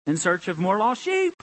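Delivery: ordinary talk, nothing marked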